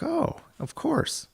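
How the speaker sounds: high, slow drawn-out oh of course; a chairman warmly recognizing a fitter, high and slow; mock delighted recognition. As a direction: sing it up high, pleasantly surprised. Chairman recognizing him, retold.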